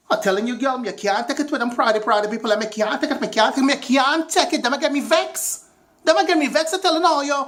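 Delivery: put-on high, vexed Caribbean accent. Fed-up cook ranting to a girlfriend about picky eaters, Caribbean accent. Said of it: "talking in my Caribbean accent".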